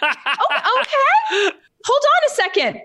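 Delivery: very high, squeaky stalling; hammed-up flustered surprise; bumbling, flustered. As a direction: play the unprepared amateur buying time. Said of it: "like, the most bumbling, awful".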